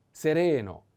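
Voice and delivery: seREno, high and loud on RE; stress on second E. Shown as a demonstration. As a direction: punch a long second E in "Sereno". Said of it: "put the accent on the second E".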